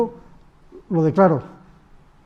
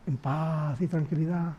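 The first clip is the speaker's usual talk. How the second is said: mock soothing calm; 3 semitones lower, 10 dB quieter, slower than usual. Mock promise of calm after talk.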